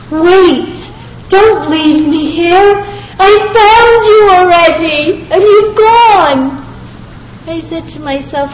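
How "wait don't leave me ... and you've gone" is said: imitating a desperate cry, higher and louder; inner scream. Speaker silently screaming after the departing rabbi, desperately. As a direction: call after the car pleading. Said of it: "I was screaming after him".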